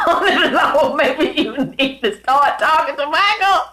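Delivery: high-pitched talk through laughter; laughing. Real, not acted. Teasing that you should talk to Michael, laughing. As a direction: crack up while getting the words out.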